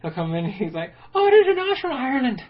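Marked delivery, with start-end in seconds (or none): 1.1-2.4 much higher, louder bad Irish accent; shitty Irish accent. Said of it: "the shittiest Irish accent".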